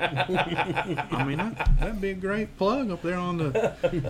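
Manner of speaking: laughing while talking. Real, not acted. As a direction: chuckle through the words. Laughing through his joke.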